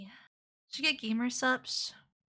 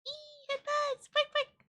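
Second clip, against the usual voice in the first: very high excited squeal; slower than usual. Pretend. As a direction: squeak it out in delight.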